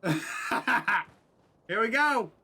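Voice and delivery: "Here we go" drawn out slowly through laughter; laughing. Real, not acted. Laughing as the clip starts.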